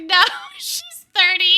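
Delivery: high, loud mock correction broken by laughter; laughing imitation. Daughter mimics mom correcting a stranger, laughing. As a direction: crack up while imitating mom.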